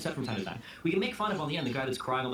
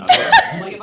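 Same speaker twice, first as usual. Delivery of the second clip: laughing; 17 dB louder, faster than usual. Real, not acted. Laughing burst at joke.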